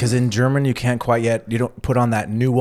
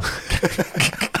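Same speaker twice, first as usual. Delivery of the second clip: breathy laugh; 7 semitones higher than usual. Real, not acted. Amused by answer.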